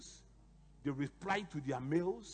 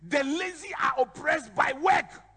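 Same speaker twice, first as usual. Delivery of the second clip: loud, higher preaching shout; 9 semitones higher, 10 dB louder than usual. Real, not acted. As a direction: hammer the verse home at full force. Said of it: "preaching the verse".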